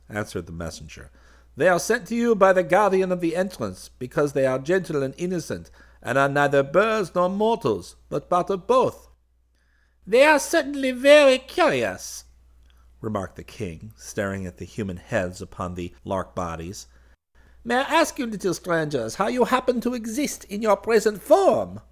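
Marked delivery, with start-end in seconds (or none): 1.6-9.1 high-pitched messenger addressing a monarch; dutiful royal messenger. Acted. Messenger presenting visitors to the bird king, high-pitched and respectful. 10.1-21.8 very high-pitched, louder regal bird voice; curious bird king. Acted. Bird king questioning strange visitors, high-pitched and regally curious.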